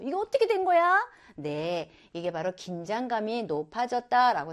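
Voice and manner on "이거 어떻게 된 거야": high, baffled question; bewildered. Retold or imitated. Mother confused in Central Park, high and baffled. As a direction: sound lost and confused.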